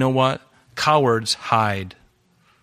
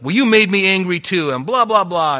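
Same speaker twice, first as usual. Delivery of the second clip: higher, louder mock snap back; mock angry retort; 8 semitones higher, 6 dB louder than usual. A believer's hammed-up angry retort to a family member. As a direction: throw it back at them in a huff. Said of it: "And we're like".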